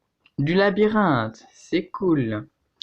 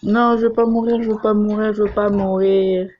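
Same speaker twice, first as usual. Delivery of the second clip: mock panic; 5 semitones higher than usual. Panicked repeated plea.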